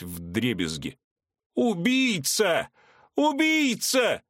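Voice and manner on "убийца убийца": very high, slow, rising screams; terrified screaming. A narrator's reading. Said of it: "завопил он".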